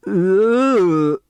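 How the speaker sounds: a sudden high strangled grunt; strangled groan. Acted. Chris groans at the news of murder, strangled. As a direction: choke out a shocked ugh.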